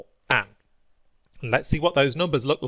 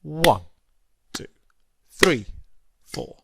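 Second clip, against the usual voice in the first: steady teacher's count of four; counting steady beats; 3 semitones higher than usual. Shown as a demonstration. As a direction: count four even crotchet beats in strict time. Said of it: "they would sound like this".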